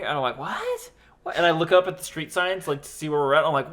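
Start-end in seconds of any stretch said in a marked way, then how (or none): 0.4-1.4 confused what. Retold or imitated. Confused after blacking out.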